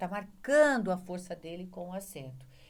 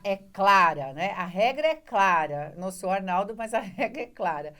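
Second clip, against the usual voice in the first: Arnaldo catchphrase; 9 dB louder than usual. Retold or imitated. Teacher quoting the TV referee to students, louder mock verdict. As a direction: declare it like a TV referee ruling on a play. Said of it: "o cara da televisão lá".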